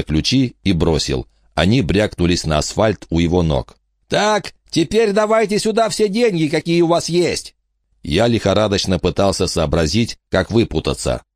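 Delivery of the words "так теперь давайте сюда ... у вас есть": high, sneering thug voice; mock the crazy old lady. Bandit demands money.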